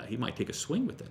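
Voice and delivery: usual speaking voice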